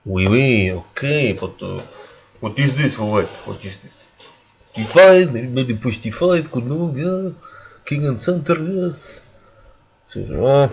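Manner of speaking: put-on botched French accent sliding into Russian; French turned Russian accent. Streamer commentating on pushing D five, wobbly French-turned-Russian accent. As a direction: start mock French, drift midway into a Russian accent. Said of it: "French turned Russian midway accent".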